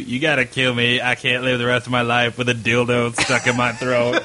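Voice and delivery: monotone buzzing throat voice; robotic, level-pitched plea; vibrator-powered voice. Retold or imitated. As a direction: imitate a head talking through a vibrator. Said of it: "use the fucking vibrator on his throat to make him talk".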